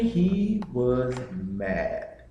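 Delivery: "mad" drawn out very slowly for weight; he was... mad, dragging out "mad"; stress on mad. Real, not acted. Son stressing how angry Pops was, slow stress on "mad". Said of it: "And when I say mad".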